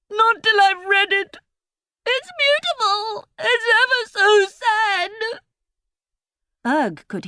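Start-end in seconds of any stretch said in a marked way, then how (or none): 0.1-5.1 tearful. A narrator's reading. Emily moved by sad book.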